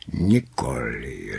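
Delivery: slow, deep beast voice; gentle beast. A narrator's reading. Beast deferring to Kráska, slow and low. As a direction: answer low and drawn out.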